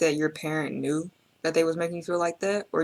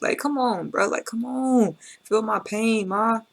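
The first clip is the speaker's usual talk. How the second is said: pleading son; 5 semitones higher than usual. Retold or imitated. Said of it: "he's mad, he's angry".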